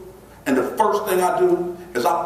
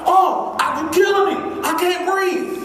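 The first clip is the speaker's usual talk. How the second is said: high, hammed-up whine of fake distress; hammed-up choking; 13 semitones higher, slower than usual. Boy faking distress to the staffer, hammed-up whine. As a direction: overplay that you're being crushed. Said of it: "So I'm all like".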